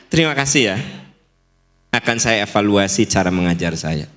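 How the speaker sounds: low, composed thanks; elegant acceptance. Shown as a demonstration. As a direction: take criticism calmly, voice dropping. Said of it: "Itu lebih elegan".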